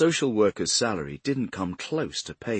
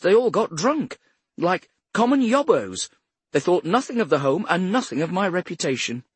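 cultured lady's high-pitched tirade, building louder; indignant older woman; 8 semitones higher, 5 dB louder than usual. A narrator's reading. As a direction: rail against ungrateful boys, increasingly indignant. Voices Mrs Swift railing at the boys.

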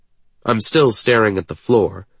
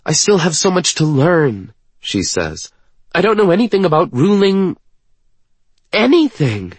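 high, louder, exasperated character voice; frustrated young woman; 10 semitones higher, 4 dB louder than usual. Acted. Woman saying she knows nothing about ruling, frustrated. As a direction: vent self-doubt in a raised pitch.

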